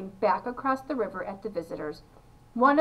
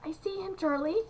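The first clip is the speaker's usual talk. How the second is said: high but softer excited reply; 7 semitones higher, 5 dB quieter than usual. A narrator's reading. Papa Joe answering Charlie about the little man, excitedly. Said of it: "said Papa Joe excitedly".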